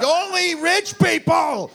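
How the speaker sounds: high, slow, loud hammed-up yell; mock shout. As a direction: bellow like a TV pundit.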